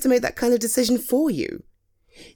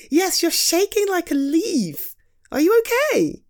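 concerned; 9 semitones higher than usual. Acted. Notices friend is scared.

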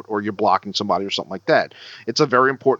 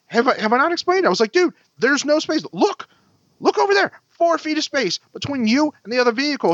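very high-pitched incredulous insistence; retold worked-up explaining; exasperated rant; 14 semitones higher than usual. Driver pointing out the space to truck owner, exasperated.

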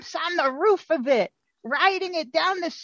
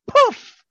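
high, loud "Poof!" for vanishing; magic poof; 10 semitones higher, 6 dB louder than usual. Acted. Fairies vanish in the story.

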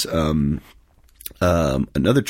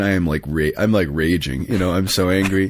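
ordinary delivery

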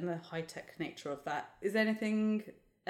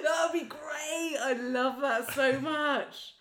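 delighted; 8 semitones higher, 5 dB louder, slower than usual. Real, not acted. Delighted by the Rick idea.

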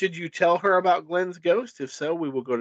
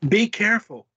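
firm, raised warning; higher, insistent caution; forceful warning; 6 semitones higher, 5 dB louder than usual. A narrator's reading. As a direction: warn with some force. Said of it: "Glenn says forcefully".

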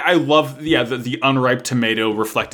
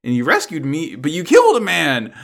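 mock outrage; 6 semitones higher, 4 dB louder than usual. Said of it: "And, and Mirin's like".

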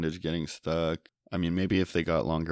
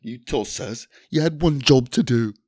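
hammed-up bad British movie accent, rising louder and higher; Basher; 5 semitones higher, 6 dB louder than usual. Shown as a demonstration. Asked for a best impersonation, exaggerated bad British accent. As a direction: do a cartoon character's fake British accent, building in force. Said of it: "Can you give us your best impersonation of, of, uh, of Basher?".

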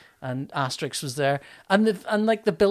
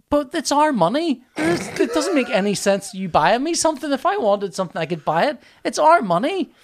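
high-pitched, incredulous inner rant; baffled protest; 9 semitones higher, 4 dB louder than usual. Retold or imitated. Husband in his head at wife's gift offer, baffled protest. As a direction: act out being utterly baffled by the offer.

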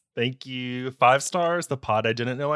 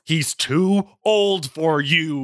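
ranting; 3 semitones higher, 6 dB louder, much slower than usual. Acted. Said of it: "he ranted".

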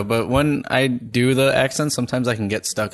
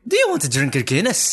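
lightly voiced Irish pub cheer; Irish accent; 4 semitones higher than usual. Shown as a demonstration. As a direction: offer the pint like a cheery Irish barman. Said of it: "stereotypical leprechaun".

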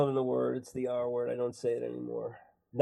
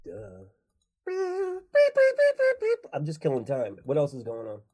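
silly character voice; 8 semitones higher, slower than usual. Acted. Silly robot character bit.

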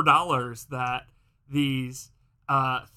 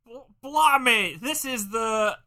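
hammed-up Cockney announcer; Cockney accent; 7 semitones higher, 5 dB louder than usual. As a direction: put on a broad Cockney showman accent. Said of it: "accent impression that you're working through".

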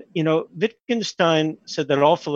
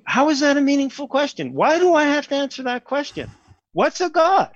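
high-pitched, incredulous protest; incredulous challenge; 12 semitones higher than usual. Acted. Answering whether there is a God, incredulous challenge. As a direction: throw the questions back in high-pitched disbelief. Acts out his challenge to question.

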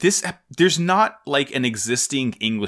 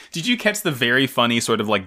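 ordinary delivery

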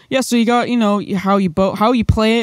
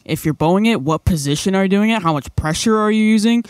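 ordinary talk, nothing marked

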